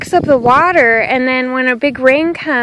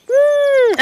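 beaver noise; 14 semitones higher than usual. Retold or imitated. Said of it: "then they're like".

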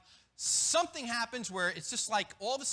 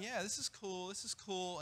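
lightly voiced snake in pew; 3 semitones lower, 7 dB quieter than usual. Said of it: "a snake sitting in the pew going".

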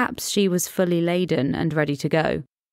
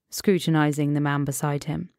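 ordinary delivery

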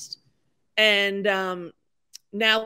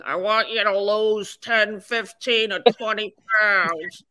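slow, drawn-out impression of someone else; Penny Marshall. Comedian recalling a director asking for weight loss, slow mimicked voice. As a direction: put on a voice, stretching the words slowly. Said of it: "I remember Penny Marshall going".

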